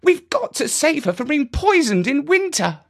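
put-on high, urgent child's plea; a boy set on saving her; earnest boy. Jeremy pleads for the cat.

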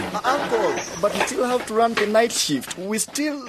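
hurried pleading. Acted. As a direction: rush the excuse out. Nephew pleads quickly.

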